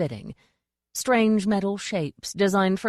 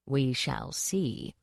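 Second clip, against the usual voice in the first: slow, soft threat; menacing duchess; 4 semitones lower, 6 dB quieter, much slower than usual. Acted. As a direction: draw it out softly, savouring the threat.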